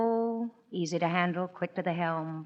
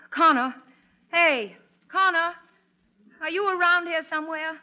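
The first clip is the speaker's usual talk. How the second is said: calling out; 9 semitones higher, 5 dB louder than usual. Acted. Calling out across the house.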